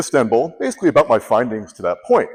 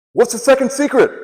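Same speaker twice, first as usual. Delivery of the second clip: high-pitched, urgent blurted question; alarmed realisation; 11 semitones higher, 4 dB louder than usual. Retold or imitated. Storyteller realises he missed the second secret, mock alarm. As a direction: act out a sudden panicked realisation. Acts out his horrible epiphany.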